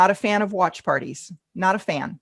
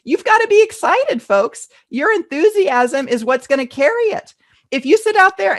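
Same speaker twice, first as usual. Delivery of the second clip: normal talking voice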